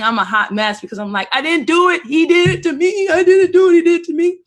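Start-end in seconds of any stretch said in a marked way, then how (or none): 1.3-4.4 much higher and louder, pleading; a scared kid insisting she's innocent; little girl crying, I didn't do it; crying child protest. Retold or imitated. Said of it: "So I'm crying".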